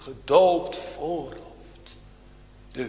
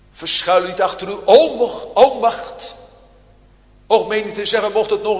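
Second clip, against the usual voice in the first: louder, pressing plea, "onmacht" hammered twice; urgent exhortation; 5 semitones higher, 8 dB louder than usual. Real, not acted. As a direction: hammer home "onmacht" loudly. Preacher urging congregation loudly.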